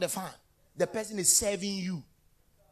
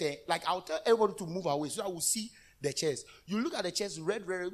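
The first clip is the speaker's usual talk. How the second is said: ordinary talk, nothing marked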